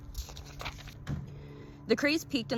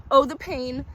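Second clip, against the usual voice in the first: loud, higher moaning lament; 7 semitones higher, 6 dB louder, slower than usual. A narrator's reading. Collector moans loudly to the stranger about his pain. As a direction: moan it out in agony. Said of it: "he moaned loudly again".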